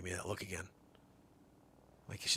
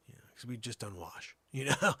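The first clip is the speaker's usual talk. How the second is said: usual speaking voice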